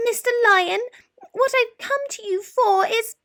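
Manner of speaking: very high, trembling mouse with squeaks; nervous squeaky mouse. A narrator's reading. Mouse nervously waking the lion to ask for roar lessons. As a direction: stammer it out, scared stiff.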